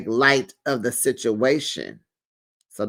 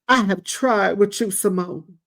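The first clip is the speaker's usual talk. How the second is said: mock crying; 5 semitones higher than usual. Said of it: "So Quad starts crying".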